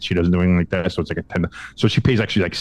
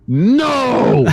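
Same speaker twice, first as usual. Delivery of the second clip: high, loud no; loud high-pitched no; mock scream; 13 semitones higher, 4 dB louder than usual. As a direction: yelp it in protest. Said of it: "I screamed".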